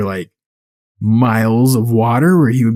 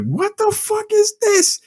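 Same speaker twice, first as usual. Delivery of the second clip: slow, very high comic disbelief; incredulous squeal; slower than usual. Retold or imitated. Friend retelling an hour without sharks, incredulous squeal. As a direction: draw it out in squeaky mock bewilderment. Repeated baffled reaction to film.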